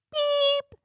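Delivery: a sharp high beep said in place of a swear word; censor beep. Shown as a demonstration. Censoring a swear word for her viewers, high mouth beep. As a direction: bleep yourself out with a quick high-pitched beep. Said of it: "I don't wanna put a, a quarter in my swear jar today".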